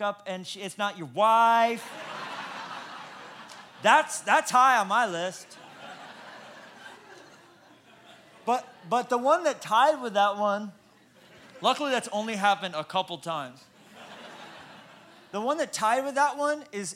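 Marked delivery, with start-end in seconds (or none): 1.9-3.8 voiceless laugh at own story; breathy laugh. Real, not acted. Preacher cracking up at his own story, breathless laugh. Punchline about wrong wife. 3.8-16.9 louder, talking through real laughter; laughing through words. Real, not acted. Preacher still laughing at his story, talking through it.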